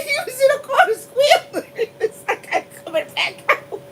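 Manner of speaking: high voice breaking into laughter; words fading into helpless giggles; laughing hard. Real, not acted. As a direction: barely get the words out for laughing. Cracking up over squealing hog.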